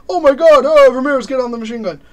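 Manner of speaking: mock war movie shout; soldier shouting. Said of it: "That's my Call of Duty quote".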